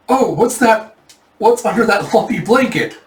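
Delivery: high, prying mock surprise; suspicious discovery. Official spots suspicious lumpy blanket.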